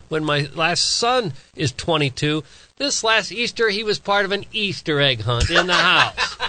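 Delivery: usual speaking voice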